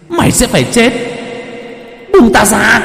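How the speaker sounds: high, loud demonic shriek; demonic threat. Acted. Possessed girl shrieks death threat at Thạnh, high and loud. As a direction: curse him with a spirit's voice. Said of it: "gằn lên từng tiếng".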